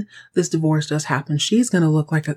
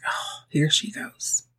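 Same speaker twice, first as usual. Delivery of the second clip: slow unvoiced whisper; weary whispered sigh; 3 semitones lower, 7 dB quieter, slower than usual. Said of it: "he's like".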